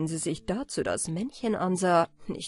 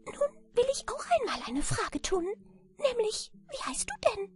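very high, squeaky little voice; tiny creature piping up, high and squeaky; piepsiges Männlein. A narrator's reading. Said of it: "Da sagte das Männchen".

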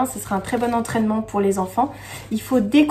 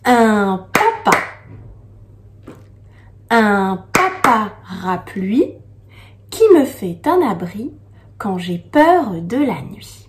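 poem reading. Acted. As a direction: read the verse gently, pausing. Reads the poem to children.

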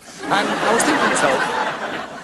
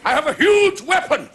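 higher pompous mock royal proclamation; boastful king; 8 semitones higher than usual. King Arnold of Wigan boasting to all, pompous mock voice. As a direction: puff up like a vain pretender king. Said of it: "He used to go around saying".

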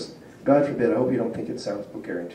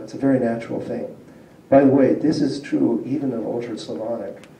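ordinary talk, nothing marked

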